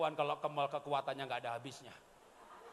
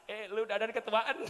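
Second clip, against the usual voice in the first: menggoda tertawa; 3 semitones higher, 4 dB louder than usual. Retold or imitated. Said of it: "Saya bilang anak saya".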